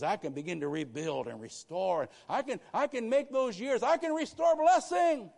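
building from loud to a high, loud shout; rising shout. Acted. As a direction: swell God's promise to a climax. Preacher voicing God, rising climax.

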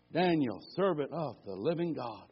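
low, soft, hushed version of the call; a soft, worried king. Acted. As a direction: say it low, almost to yourself. Quiet retelling of king's call.

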